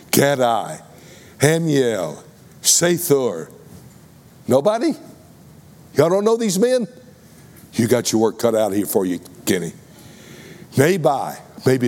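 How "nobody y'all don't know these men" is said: pitched way up in mock disbelief; mock surprise. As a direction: act stunned that nobody knows them. Congregation knows none of the names.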